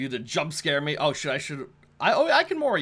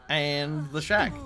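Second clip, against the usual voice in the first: ordinary talk, nothing marked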